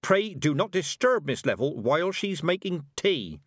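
a hint of a commanding old woman; firm, no-nonsense old witch; stern old witch. Weatherwax voiced.

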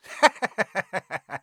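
cold, sharp villain laugh. A narrator's reading. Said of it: "Quirrell laughed".

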